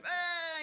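high, loud startled scream; girlfriend's mom shrieking in shock. Retold or imitated. Mom seeing his bloody face at the door, loud shriek. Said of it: "she just starts screaming".

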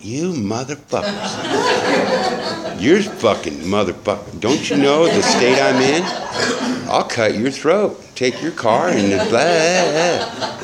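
half-laughing imagined tirade, pitched high; a hammed-up inner rage, laughing through it; mock furious rant. Refused burger money, he imagines cursing the men in mock fury. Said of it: "That's my thinking".

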